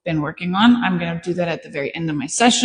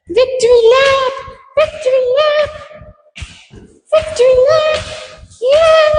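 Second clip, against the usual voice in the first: shouting much higher, louder, laughing; ecstatic praise; 4 dB louder, much slower than usual. Real, not acted. Trainer celebrates the dog's success, ecstatic shouting with laughter. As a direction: celebrate wildly, victory lap.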